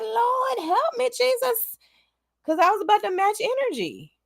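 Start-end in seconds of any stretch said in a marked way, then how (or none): 0.0-1.8 pleading prayer. Retold or imitated. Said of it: "I said".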